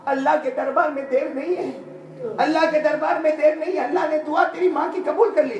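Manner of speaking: high, impassioned, imitated tearful cry; retold weeping. Musa telling the son his mother's prayer is accepted, imitated weeping. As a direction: proclaim it high, with a touch of tears. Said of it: "रोते रोते कहा".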